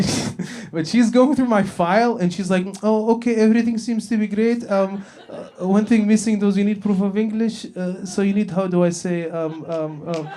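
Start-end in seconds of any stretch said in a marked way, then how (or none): 2.8-10.3 higher-pitched agent groping for words; struggling immigration agent. Acted. Immigration agent reviewing his file, hesitant and higher-pitched. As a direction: hem and haw through halting English. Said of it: "struggling to find the word".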